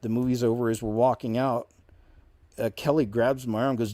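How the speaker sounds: ordinary delivery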